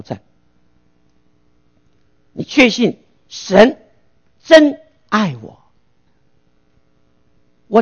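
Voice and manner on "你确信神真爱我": slow emphasis. Real, not acted. Sermon emphasis on God's love.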